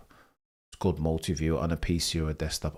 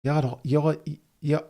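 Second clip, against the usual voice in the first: high-pitched, faltering stab at Scouse; attempted Scouse accent; 9 semitones higher, 4 dB louder than usual. Streamer trying a requested accent for chat, halting Scouse attempt. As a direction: try Scouse and give up. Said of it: "give us your best Scouse accent".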